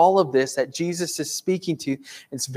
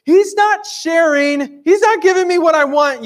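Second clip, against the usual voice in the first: put-on whining kid; 11 semitones higher, 5 dB louder than usual. Kid complaining to parent about brother not sharing, whiny. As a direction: play a kid tattling to a parent. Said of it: "you had a kid come up to you".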